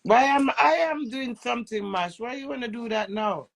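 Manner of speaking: ordinary delivery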